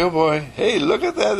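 plain reading voice

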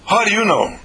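high, indignant mock female retort; startled caller. Acts out caller's startled challenge.

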